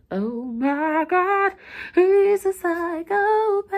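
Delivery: high, slow drawn-out disbelief; stunned aside. Real, not acted. Writer reacting to her own chapter, high and slow. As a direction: gasp at your own plot twist.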